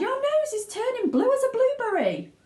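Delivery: very high, loud frantic cry; horrified wail; screaming. Acted. Mother screams at Violet's blue nose. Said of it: "screamed Missus Beauregard".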